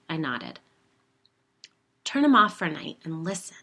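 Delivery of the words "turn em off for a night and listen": insistent. A narrator's reading. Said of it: "she insisted".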